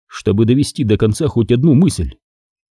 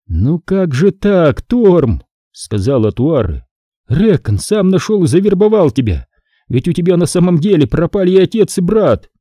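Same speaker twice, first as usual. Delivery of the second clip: reproachful alien woman; 7 semitones higher than usual. Acted. Atuare reproaches Torm.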